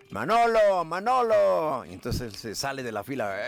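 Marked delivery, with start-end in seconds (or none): 0.1-1.9 high, loud, long-drawn name call; acted calling shout. People yelling at a man in the cinema line, drawn-out calling shout. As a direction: holler the name across a crowd. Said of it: "empiezan a gritar".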